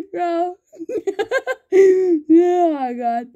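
high wheezing laugh, close to tears; can't stop laughing, squeaky and breathless; helpless laughter. Real, not acted. Cracking up at something in the game, wheezing.

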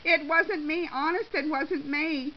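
high, loud pleading denial; yelled protest. A narrator's reading. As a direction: plead innocence as you're dragged away. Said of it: "Shirley yelled as she was dragged".

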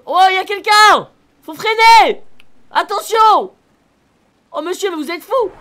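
high, loud panicked yelling; real alarm, shouting to brake; startled shout. Real, not acted. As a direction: yell in alarm as someone jumps in front. Nearly hits pedestrian in rain.

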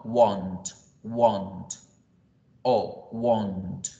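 "want" said low with a long "o" vowel. Shown as a demonstration. Teacher stressing the O vowel in "want". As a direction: lean on the "O" in "want". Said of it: "con O".

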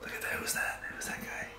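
hushed, unvoiced stage whisper; mock secret whisper. Pretending to spot [name], playful whisper. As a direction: whisper it like a sneaky star-spotter.

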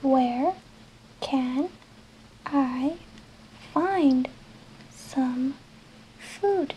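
one word at a time with long pauses; slow dictation for learners. Shown as a demonstration. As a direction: dictate each word slowly for writing. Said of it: "Escríbelo conmigo".

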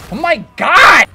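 loud, high, drawn-out yell; shocked shout. Real, not acted. Gamer killed by an unexpected weapon, shocked shout. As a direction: stretch it into a shout.

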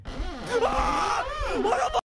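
high frantic screams while fleeing; terrified screaming. Real, not acted. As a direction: scream while being chased. Chased by a character, screaming.

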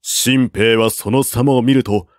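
usual speaking voice

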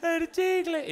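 put-on high, loud, tearful wail as a little boy; sobbing child. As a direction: wail the words between sobs. Crying boy tells his mother.

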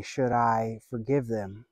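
ordinary talk, nothing marked

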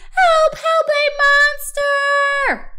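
high, loud cry, slowing on monster; panicked shout. A narrator's reading. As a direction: scream for help in fright. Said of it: "shouted Baby".

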